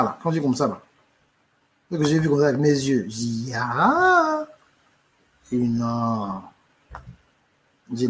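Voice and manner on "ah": long cri d'effroi; cri de peur. Retold or imitated. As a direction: pousse un ah effrayé et étiré. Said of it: "moi, j'ai eu peur". (ah terrifié, lent et étiré)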